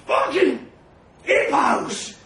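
high, loud raging grunt; grunted shout. A narrator's reading. Trev rolling about on top of him, grunting loudly. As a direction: force it out in a furious shout. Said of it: "grunts Trev".

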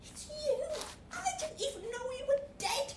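high, fast excited outburst. Acted. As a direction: blurt it out in delighted shock. Shocked recognition of a ghost.

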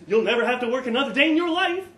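laughing, fast and loud. Retold or imitated. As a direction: push through a laugh, quickly. Boss laughing while talking.

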